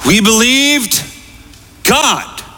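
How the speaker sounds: "We believed God" high, with a pause before "God"; emphatic repeat. Real, not acted. Preacher drives home the reason to congregation, "We believed God" emphatic. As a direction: pitch up "believed", pause, then land "God" on its own.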